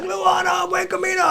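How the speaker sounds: high-pitched retold holler; calling back. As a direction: hail a fellow traveller from afar. Shouted greeting back to friend.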